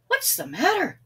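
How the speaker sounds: surprised question. A narrator's reading. Villagers ask Sam.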